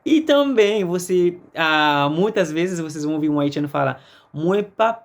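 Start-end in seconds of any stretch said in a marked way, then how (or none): none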